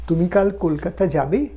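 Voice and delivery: rising question with the lift on যাবে; rising question tune. Shown as a demonstration. Teacher showing a question by tune alone. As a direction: end যাবে on a clear upward lift. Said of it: "এভাবেও প্রশ্ন করা যায় মানুষকে".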